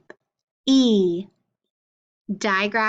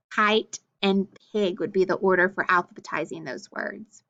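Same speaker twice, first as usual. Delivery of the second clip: usual speaking voice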